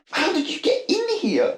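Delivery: normal talking voice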